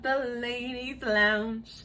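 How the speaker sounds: normal talking voice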